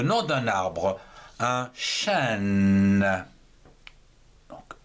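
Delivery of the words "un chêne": chêne held long and low; drawn-out word. Shown as a demonstration. Teacher writing up the name of a tree, drawn out. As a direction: stretch chêne out, sinking in pitch onto a long n.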